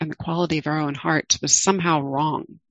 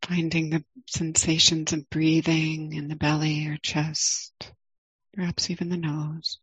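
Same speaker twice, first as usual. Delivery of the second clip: guided meditation. Acted. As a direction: ease listeners into the body, gently.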